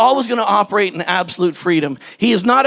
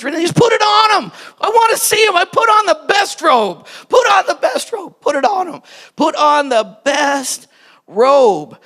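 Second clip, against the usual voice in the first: father's joyful shout; 7 semitones higher than usual. Acted. Father calling to his servants, high joyful shout. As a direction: shout it out like an overjoyed dad.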